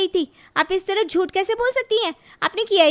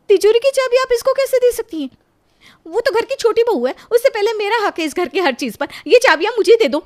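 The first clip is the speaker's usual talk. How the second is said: higher, louder indignant outburst; angry protest; 4 semitones higher, 7 dB louder than usual. Acted. Elder daughter-in-law demands safe keys, loud angry protest. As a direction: demand the keys back, furious.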